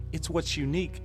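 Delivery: usual speaking voice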